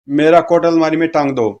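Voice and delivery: plain reading voice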